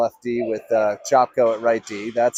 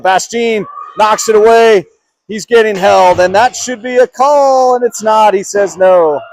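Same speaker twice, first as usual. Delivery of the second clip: loud, high protest at the referee; heated call; 7 semitones higher, 8 dB louder than usual. Real, not acted. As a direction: raise your voice over the missed penalty. Commentator protests a missed penalty.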